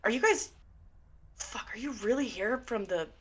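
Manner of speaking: resigned shock turning to a yell; dismayed older woman. Acted. Atticus dismayed at seeing them arrive. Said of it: "she goes".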